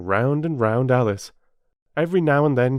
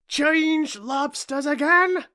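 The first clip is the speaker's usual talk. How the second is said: much higher, loud slow yell; Griffin yells; 6 dB louder, slower than usual. A narrator's reading. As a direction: yell it out in glee. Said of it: "yelled the Griffin".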